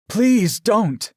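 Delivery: high, loud, desperate cry; yelled plea. A narrator's reading. Captive pleading for Aaron's life, yelled. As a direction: cry out, begging him to stop. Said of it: "I yelled".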